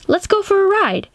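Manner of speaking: high, excited invitation to a pet; excited call to dog. Acted. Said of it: "when I say".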